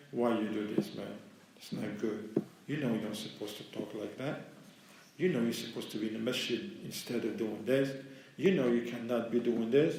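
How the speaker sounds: low, soft voice of conscience; quiet reproach. Acted. Soul to a man who fell short, quiet reproach. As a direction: chide softly, as an inner voice. Nafs reproaching a negligent person.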